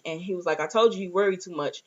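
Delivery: ordinary talk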